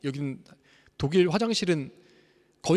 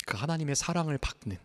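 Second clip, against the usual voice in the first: ordinary delivery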